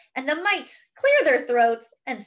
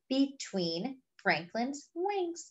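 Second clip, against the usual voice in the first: ordinary delivery, nothing marked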